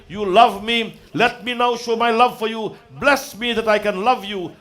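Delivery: high, impassioned plea to God; fervent prayer. Acted. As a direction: implore the Lord with rising devotion. Prays aloud at full force.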